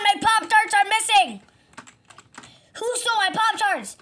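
high, loud panicked cartoon voice; frantic game character. Victim rallies his army.

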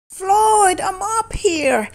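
high voice calling from above; called out. Acted. Jerry calls down to Floyd from the cupboard. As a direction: call down from the cupboard top. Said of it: "someone called".

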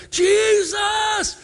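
a high, loud shout across a crowd; desperate cry. Acted. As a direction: call out with everything you have. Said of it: "Bartimaeus called out".

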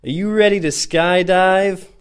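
loud, slow, high Canadian yell; Canadian accent shout. Retold or imitated. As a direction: bellow it in a thick Canadian accent. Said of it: "shouted in a thick Canadian accent".

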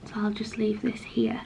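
soft whispered aside. Real, not acted. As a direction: breathe it out like a secret. Quiet aside while unboxing.

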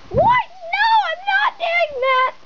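high-pitched play-acted outrage; shocked refusal. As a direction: squeal in mock horror. Patient refuses doctor's shocking demand.

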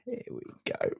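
low whispered aside to self; whispering. Real, not acted. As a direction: whisper it under your breath. Muttering to himself while playing.

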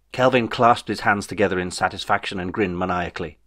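usual speaking voice

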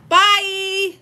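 high, loud drawn-out bye; bright stretched farewell holler; calling goodbye. Real, not acted. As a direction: sing out the sign-off to the viewers. Signing off to viewers.

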